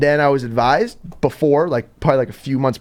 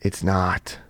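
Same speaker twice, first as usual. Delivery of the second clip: flat denial; 8 semitones lower, 6 dB quieter than usual. Retold or imitated. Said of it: "He goes".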